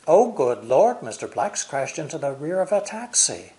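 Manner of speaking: loud, high "Oh, good Lord"; alarmed exclamation. Acted. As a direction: exclaim it high, as the story's climax. Exclamation at story's crash.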